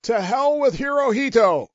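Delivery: a sergeant demonstrating the yell; mock yell. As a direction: shout it like a rallying cry. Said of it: "you yell at the top of your voice".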